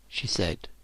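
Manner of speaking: ordinary delivery, nothing marked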